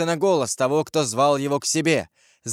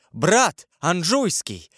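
higher-pitched cry of mild horror; horrified exclamation; 5 semitones higher, much slower than usual. A narrator's reading. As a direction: recoil at the brother's arrival. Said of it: "с ужасом воскликнул Франсуа". (воскликнуть с ужасом)

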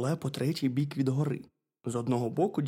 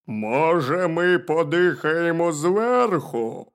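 loud, slow bear calling down; raised, booming bear voice; little bear; 5 semitones higher, 10 dB louder, much slower than usual. A narrator's reading. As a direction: holler it slowly as a bear. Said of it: "запитав ведмедик".

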